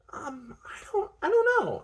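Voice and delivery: high-pitched, halting uncertainty; stall, then squeak out an unsure answer; hesitant reply. A narrator's reading. Woman unsure about her friend's portraits, hesitant and high.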